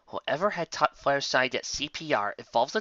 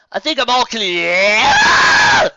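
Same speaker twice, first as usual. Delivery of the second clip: slow, high, loud yell; Spike's scream; 11 semitones higher, 12 dB louder, much slower than usual. Acted. Spike yelling from the bathroom to Twilight, loud shout. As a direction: bellow it out from the next room. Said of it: "awoken by Spike's scream".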